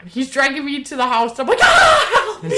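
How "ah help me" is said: high, loud hammed-up scream for help; mock scream. Dragged into friend's house, hammed-up scream for help. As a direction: shriek like being dragged off. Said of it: "I'm screaming".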